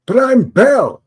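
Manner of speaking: indignant little inventor's voice; hammed-up Bell objecting; voicing Bell. Voicing Bell answering photographer.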